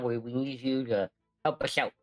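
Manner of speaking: ordinary delivery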